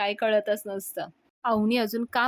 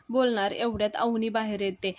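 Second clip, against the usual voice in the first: usual speaking voice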